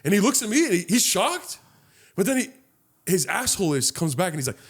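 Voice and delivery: normal talking voice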